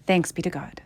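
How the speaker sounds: normal talking voice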